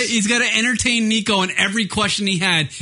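usual speaking voice

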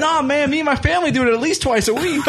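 very high, breezy put-on voice with a laugh; tossed-off like it's nothing, squeaky and amused; high cartoon wrestler brushing it off to his buddy; Honky Tonk Man impression. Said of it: "He goes".

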